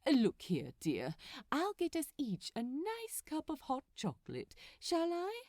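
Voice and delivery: fussy, prim angel fretting about the haunted house; a touch of a finicky gentleman, done lightly; lightly voiced Aziraphale. A narrator's reading. Angel complaining to Crowley, lightly voiced fussy gentleman.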